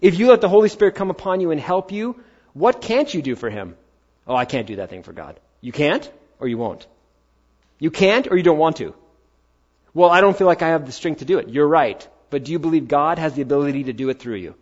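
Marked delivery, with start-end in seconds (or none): 4.3-5.4 mock reluctant excuse. Voicing a reluctant listener. 9.9-11.5 mock whiny excuse. Voicing a reluctant listener.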